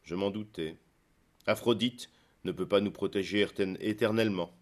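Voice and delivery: plain reading voice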